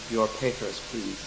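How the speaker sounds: put-on low, quiet German-accented demand. Guards to captured traveller, German accent. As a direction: imitate a German official, softly and deliberately. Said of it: "In a German accent, they ask me".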